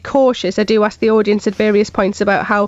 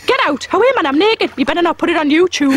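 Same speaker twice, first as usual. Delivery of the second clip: Geordie otter voice; 6 semitones higher than usual. Pretend. A Geordie otter on TV, crooning then shooing the camera away. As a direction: play a Geordie otter caught in the bath. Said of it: "pretends to be an otter and says things like this".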